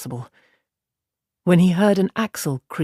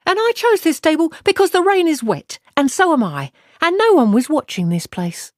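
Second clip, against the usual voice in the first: much higher, louder proud retort; haughty woman; 10 semitones higher, 4 dB louder than usual. Acted. Aludra answering Matt after the fight, proud and high. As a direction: answer with cool pride.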